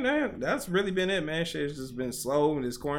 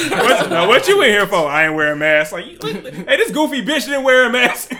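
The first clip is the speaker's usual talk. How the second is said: loud, higher-pitched laughing jeer; laughing mockery; 8 semitones higher, 11 dB louder than usual. Inmates mocking the man jailed over a mask, laughing loudly. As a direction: crack up while loudly mocking a cellmate.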